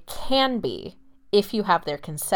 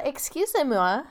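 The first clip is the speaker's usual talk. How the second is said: put-on French accent; 6 semitones higher than usual. Mock offended in French.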